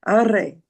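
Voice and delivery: "arre" with a trilled, rolling R; rolled Spanish R. Shown as a demonstration. Teacher demonstrating the Spanish trill, rolled R. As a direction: roll the R in "arre" like a Spanish speaker. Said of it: "'cause they roll their tongue".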